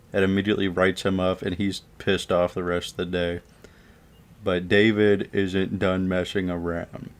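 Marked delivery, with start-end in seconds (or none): none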